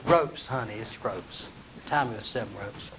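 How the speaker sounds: low, soft drowsy request; lightly voiced Samson lying down, low and soft; lazy Samson. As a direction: mumble through a back rub. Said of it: "He said".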